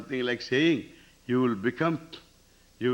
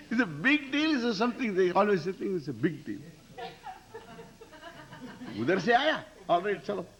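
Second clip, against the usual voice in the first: laughing; 8 semitones higher, faster than usual. Real, not acted. Laughing while mocking the demand.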